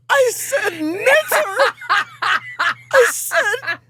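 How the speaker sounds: high, loud, incredulous echo of the word; mounting indignant repeat. Retold or imitated. Repeating the insult back at the woman, loud and high.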